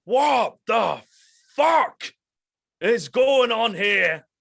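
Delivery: the captain bursts in and bellows at everyone; bellowing captain. Acted. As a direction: bellow it across the room at full force. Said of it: "you know, bellows".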